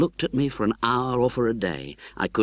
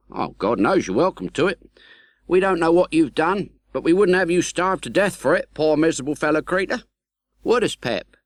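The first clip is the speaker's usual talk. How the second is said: high, louder heartfelt kindness; kind, earnest Joe; 9 semitones higher, 5 dB louder than usual. A narrator's reading. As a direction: reassure the starving man kindly. Joe kindly forgives the convict.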